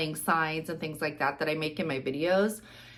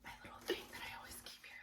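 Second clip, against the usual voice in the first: whispering; 22 dB quieter, much faster than usual. Real, not acted. Whispered aside about kept item.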